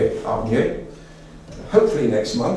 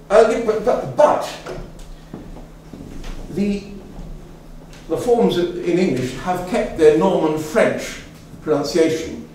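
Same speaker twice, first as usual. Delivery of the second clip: ordinary talk, nothing marked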